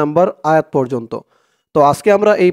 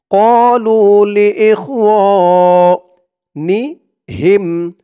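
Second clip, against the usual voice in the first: slow, higher chanted recitation; Quran recitation; 3 semitones higher, 4 dB louder, much slower than usual. Shown as a demonstration. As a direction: draw out every vowel like tajweed. Recites the phrase.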